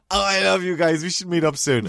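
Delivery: put-on high, effusive drunk-text voice; drunk texter. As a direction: draw it out like a soppy drunk. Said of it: "I generally text Anne or J P hammered".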